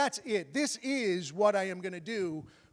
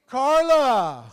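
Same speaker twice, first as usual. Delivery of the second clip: loud, high drawn-out holler; yelled call; 6 semitones higher, 12 dB louder than usual. Retold or imitated. Failing comic calls to his girlfriend at the bar, yelled. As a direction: shout the name out across a room. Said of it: "he yells out".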